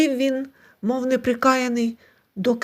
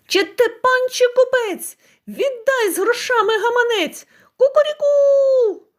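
much higher, loud rooster cry with a long drawn crow; 11 semitones higher, 4 dB louder than usual. A narrator's reading. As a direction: crow it out, triumphant and insistent. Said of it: "знову за своє".

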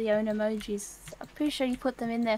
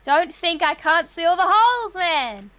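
playful shout; 5 semitones higher, 9 dB louder than usual. Real, not acted. Catching partner digging holes.

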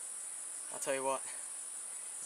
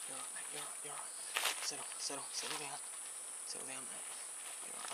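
soothing murmur. Real, not acted. Calming a king brown snake.

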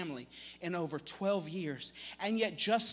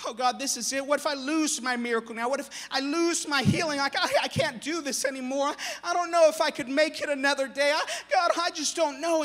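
tearful woman; 6 semitones higher, 4 dB louder than usual. Acted. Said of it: "tears are streaming down her face".

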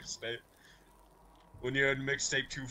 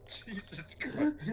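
laughing whisper; 8 semitones higher, 7 dB quieter, much slower than usual. Real, not acted.

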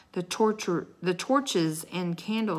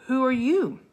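ordinary delivery

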